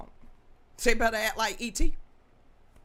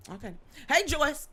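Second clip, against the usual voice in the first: normal talking voice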